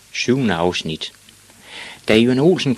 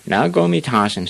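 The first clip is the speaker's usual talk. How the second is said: biggest bully; 6 semitones higher, 4 dB louder than usual. Acted. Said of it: "sagde den største dreng".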